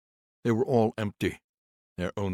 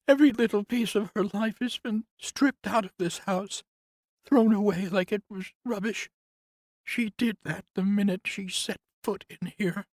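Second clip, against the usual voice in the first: much higher, louder woman's voice, bitter; grieving nurse; 5 dB louder than usual. Acted. Nurse complains to Conrad about the house, higher voice. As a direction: lift into the nurse's aggrieved voice.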